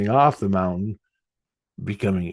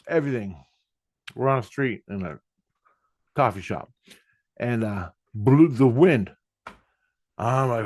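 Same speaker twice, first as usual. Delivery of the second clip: ordinary delivery, nothing marked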